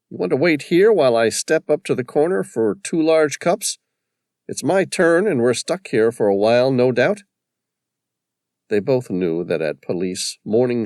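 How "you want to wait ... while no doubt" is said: higher voice for Virginia; lightly voiced paramedic, offering coffee; character voice. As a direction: play her, light and teasing.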